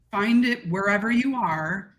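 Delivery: ordinary talk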